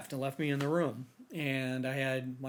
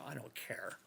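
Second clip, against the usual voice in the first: whispered refusal; 14 dB quieter than usual. Retold or imitated. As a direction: whisper it, flat and defeated. Repeats refusal to the officers.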